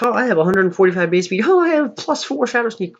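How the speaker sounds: high, silly put-on creature voice; mock Beedrill voice. Imitating Mega Beedrill boasting about its stats, high silly voice.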